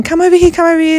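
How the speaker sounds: high, eager fan calls; excited calling. Retold or imitated. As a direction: call out like excited fans. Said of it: "they were going".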